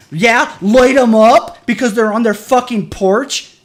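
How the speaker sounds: loud, high, slow sarcastic mockery. Real, not acted. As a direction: throw his words back at him. Mocking the cop's order angrily.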